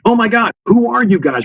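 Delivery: high-pitched imitation of an astonished reporter; amazed journalist. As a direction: exclaim it as if awestruck by strangers. Imitating amazed journalists meeting soldiers.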